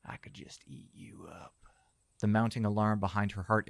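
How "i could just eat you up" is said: lecherous whisper. Acted. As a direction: breathe it into her ear. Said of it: "He whispered lecherously into her ear".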